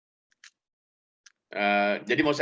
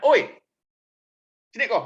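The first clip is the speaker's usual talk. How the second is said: raised call to come over; friendly call; 6 semitones higher, 6 dB louder than usual. Retold or imitated. Official beckoning a familiar student over, raised call.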